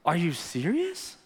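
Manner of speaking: rising, incredulous question. Acted. Preacher voicing Jesus' rebuke to the critics, incredulous. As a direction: draw it out in disbelief, lifting sharply at the end.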